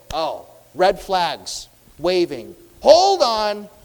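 loud, drawn-out objection; mock outraged call to halt; acted alarm. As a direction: raise the alarm, building to a shout. Acts out scribes' alarm at blasphemy.

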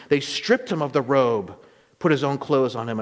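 ordinary delivery

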